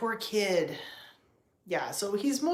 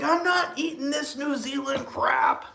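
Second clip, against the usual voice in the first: cat voice; 6 semitones higher than usual. Pretend. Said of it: "He's like".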